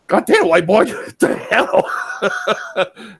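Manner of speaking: high, loud, laughing disbelief; amazed waiter. Retold or imitated. Honduran waiter amazed at the gringo eating hot sauce, laughing disbelief. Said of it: "waiter amazed at hot sauce".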